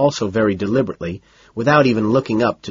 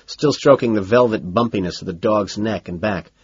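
usual speaking voice

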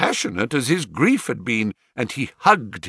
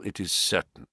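plain reading voice

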